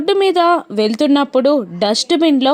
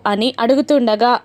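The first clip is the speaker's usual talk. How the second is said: usual speaking voice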